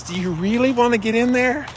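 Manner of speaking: high, doubtful inner voice; doubting inner voice. Retold or imitated. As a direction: voice your hesitant brain. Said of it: "where my brain says".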